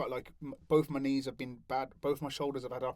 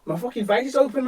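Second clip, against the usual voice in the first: usual speaking voice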